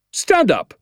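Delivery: high, sharp command; high-pitched teacher's yell; shouted order. Acted. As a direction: snap it out like a drill sergeant. Angry teacher orders pupil up.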